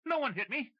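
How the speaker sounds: high, loud, too-loud denial. Acted. McCracken denying to Tim, much too loud. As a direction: blurt the denial far too loudly. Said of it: "too loud for Tim Jacoby's liking".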